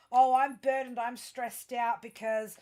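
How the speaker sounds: loud, hammed-up stressed-out whining; mock complainer. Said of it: "with your whole".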